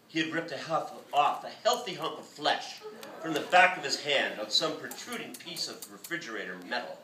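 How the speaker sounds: plain reading voice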